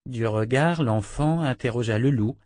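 ordinary delivery

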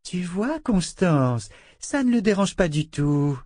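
high-pitched, taunting sweetness; mocking cruelty. A narrator's reading. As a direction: make light of the victim's pain. Torturer mocking the begging victim.

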